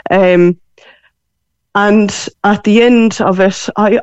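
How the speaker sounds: ordinary talk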